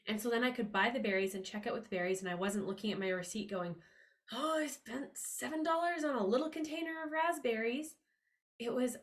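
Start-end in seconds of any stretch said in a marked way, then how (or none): 4.3-8.0 mock dismay. Mock dismay reading the receipt.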